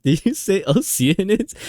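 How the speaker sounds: high, loud, slow mock question; laugh-laced incredulous sidekick; imitating Paimon.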